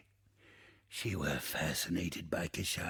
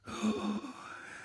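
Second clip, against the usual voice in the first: soft gasp; 12 semitones higher, 5 dB quieter than usual. Acted. Gasps mid-reverie.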